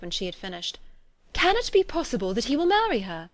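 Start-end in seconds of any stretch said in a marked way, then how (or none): 1.3-3.3 higher-pitched, amazed question; incredulous cry. A narrator's reading. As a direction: ask in disbelief, pitch swooping up. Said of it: "cried Elizabeth".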